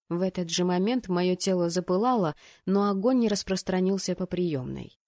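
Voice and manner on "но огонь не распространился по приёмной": plain reading voice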